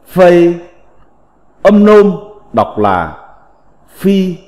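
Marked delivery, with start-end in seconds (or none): 0.1-0.7 "Phây" on a level Mandarin tone; Mandarin reading. Shown as a demonstration. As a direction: hold "Phây" level, as in Chinese. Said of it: "Phiên âm tiếng Trung đọc là".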